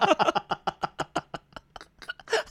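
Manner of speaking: long high laugh, falling away. Real, not acted. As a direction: burst out laughing. Laughing at own army story. (放声大笑)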